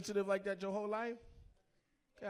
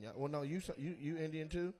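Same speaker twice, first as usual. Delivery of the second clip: usual speaking voice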